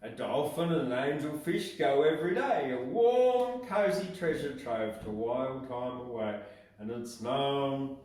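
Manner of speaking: put-on gently reciting a children's poem; poem reading. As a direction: read it like a storybook rhyme. Reads seahorse poem standing.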